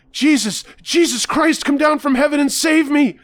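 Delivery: very high, loud shrieking plea; frantic screaming prayer. Acted. Bloodied man screaming at heaven to be saved, frantic shriek.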